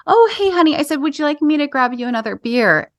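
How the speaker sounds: sweet as pie, overly nice; nicest wife voice. Retold or imitated. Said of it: "practice with my nicest voice ever".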